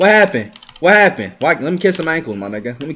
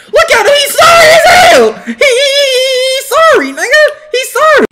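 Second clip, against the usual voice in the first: high, loud, cracking with laughter; laughing mockery; 7 dB louder than usual. Real, not acted. Gamer laughing at a bad opponent, howling mockery.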